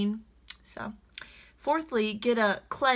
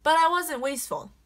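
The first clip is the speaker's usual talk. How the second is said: high, louder indignant protest; 7 semitones higher, 4 dB louder than usual. Real, not acted. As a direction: insist you did nothing wrong. Indignant defense.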